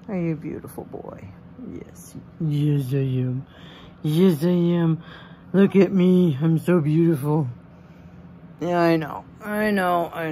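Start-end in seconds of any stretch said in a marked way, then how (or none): 0.1-2.1 tender whisper to the pig. Real, not acted. Cooing at the pig. 2.4-7.5 louder, proud pet voice; voicing the pig.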